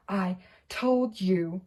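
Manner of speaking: slow, breathless gasp; out of breath after running. Acted. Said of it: "Hermione gasped".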